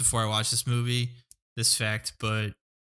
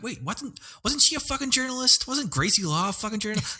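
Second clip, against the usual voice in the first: confused outburst; 8 semitones higher than usual. Retold or imitated. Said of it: "I'm like".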